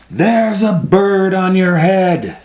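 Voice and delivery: high, loud announcement, stretched out; Piggy calling out. Acted. Piggy telling Elephant about the bird, calling out high. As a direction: call it out high and bold.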